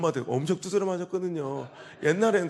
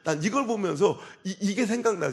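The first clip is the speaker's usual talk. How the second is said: ordinary talk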